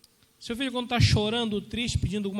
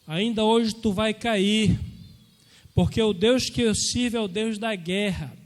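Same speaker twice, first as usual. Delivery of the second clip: David defying Goliath; 6 dB louder than usual. Retold or imitated. Young David taunting the giant, loud and defiant. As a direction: hurl the challenge at the giant. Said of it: "E Davi falou".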